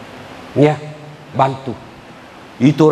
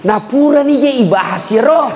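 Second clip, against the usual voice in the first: mock wife voice; 10 semitones higher than usual. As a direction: play the wife, half laughing. Voicing wife and husband. (suara istri pura-pura)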